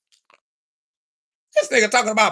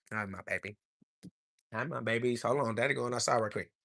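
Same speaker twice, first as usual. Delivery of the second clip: quiet aside; 9 semitones lower, 13 dB quieter, faster than usual. Real, not acted. Talking to his kids aside.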